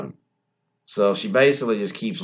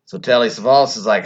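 ordinary talk, nothing marked

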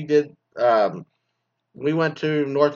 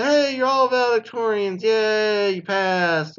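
high, sarcastic cheer; fake-celebrate everyone passing; mock cheer; 8 semitones higher, slower than usual. School announcing that everyone is valedictorian, sarcastic high cheer.

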